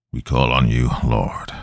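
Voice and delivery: low, solemn invocation; grave man calling on God; exorcist's prayer. A narrator's reading. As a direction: pray low and steady. Mike prays over possessed girl.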